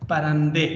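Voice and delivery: परंदे said with a final ए; Persian pronunciation. Shown as a demonstration. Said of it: "फारसी में ये लफ्ज़ क्या है". (फ़ारसी में परंदे, ए पर ख़त्म)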